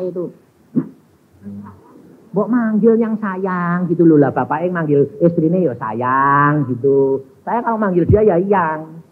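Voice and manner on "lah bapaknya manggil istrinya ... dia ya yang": ordinary delivery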